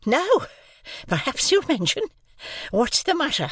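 high, broken phrases with gaps for breath. A narrator's reading. Sister after pouncing on Joe, out of breath. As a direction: sister winded, demanding in short bursts. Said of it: "out of breath".

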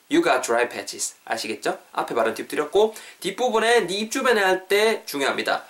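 normal talking voice

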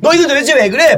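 high, self-righteous pupil scolding; mock class president. Class president on cue after the teacher leaves, cringey reproach. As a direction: imitate a bossy class monitor. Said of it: "반장, 부반장이 나와서".

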